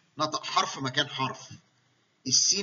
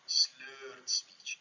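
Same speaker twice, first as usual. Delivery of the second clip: whispered demo; 5 dB quieter than usual. Shown as a demonstration. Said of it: "بيتكلم بالطريقه دي".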